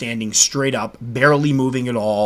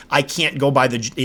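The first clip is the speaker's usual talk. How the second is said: ordinary delivery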